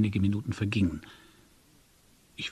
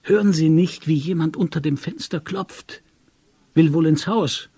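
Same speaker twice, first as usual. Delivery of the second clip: louder, higher urgent question; raised voice, on edge; agitated; 6 semitones higher, 5 dB louder than usual. A narrator's reading. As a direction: alert a sleepy friend to a noise. Agitated friend hears knocking at window.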